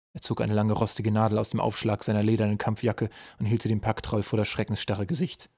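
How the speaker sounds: plain reading voice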